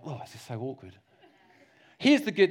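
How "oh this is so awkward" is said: quiet, lower mutter to oneself; awkward mutter. Retold or imitated. Preacher imitating his embarrassed inner voice, quieter. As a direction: mutter it under your breath. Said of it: "It's like".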